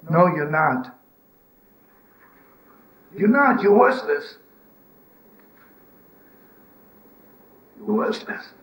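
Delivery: high, scornful berating; a voice scolding its own owner, scornful and high; harsh inner voice. Retold or imitated. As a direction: tear yourself down with contempt.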